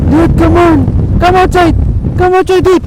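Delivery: loud, high urging shouts; shouting at the fish. Real, not acted. Angler yelling at a snagged fish, loud and high. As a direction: yell at it to come out.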